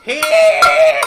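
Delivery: piercing, very high scream; creature scream. Acted. Creature turns round to summon its kin, screaming. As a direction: scream it as loud and high as you can. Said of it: "He screams in a strange Tuhulian".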